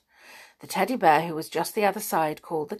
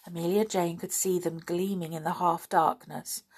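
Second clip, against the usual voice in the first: plain reading voice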